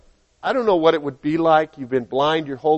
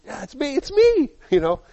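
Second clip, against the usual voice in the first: much higher, insistent yes it's me; healed man insisting to doubters; eager reassurance; 10 semitones higher than usual. Acted. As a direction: plead with delight to be believed. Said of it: "Is this really you?".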